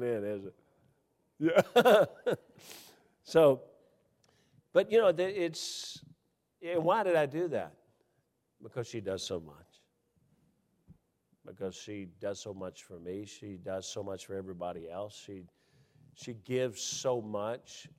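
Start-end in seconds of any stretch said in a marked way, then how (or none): 1.4-2.4 laughing yeah. Real, not acted. Laughing with audience. 8.6-17.9 much softer, lower, heartfelt; soft and sincere. Real, not acted. Tender soft tribute to wife.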